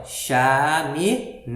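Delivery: "Chaminé" slowly by syllables, weight on long NÉ; stress on last syllable. Shown as a demonstration. Teacher reminding pupils of the last syllable, stressed ending. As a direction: stretch out "Chaminé", landing on the final "né". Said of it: "Um pouco mais forte".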